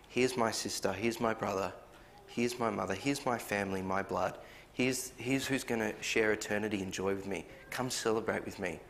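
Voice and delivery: welcoming Jesus, softly. Acted. Jesus welcoming his family, quoted.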